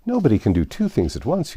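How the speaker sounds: high, fluttery queen voice; a dithering queen chattering on. Acted. Flustered Queen talking to Alice.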